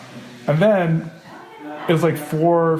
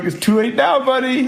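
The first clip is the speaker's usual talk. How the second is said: high-pitched teasing retort; playful, raised-voice comeback; mock exclamation; 9 semitones higher than usual. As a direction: imitate a friend's comic protest, voice pitched up. Friend's retort voiced in story.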